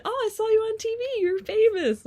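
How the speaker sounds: high, gushing imitation; excited chiropractor. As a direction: mimic a starstruck fan. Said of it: "my chiropractor would mention".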